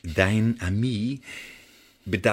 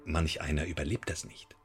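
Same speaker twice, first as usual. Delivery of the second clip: quiet menace; 5 semitones lower, 9 dB quieter than usual. Acted. Interrogator softly warns the spy he may not survive. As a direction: lower and softer, a cold aside.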